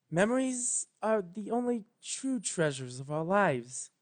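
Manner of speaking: careful Celestia. A narrator's reading. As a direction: pick each word with care, gently. Celestia voiced.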